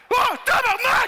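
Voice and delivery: high, loud shocked curse; sudden high-pitched exclamation; shocked outburst. Acted. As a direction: yelp a swear word in horrified surprise. Gynecologist shocked during exam.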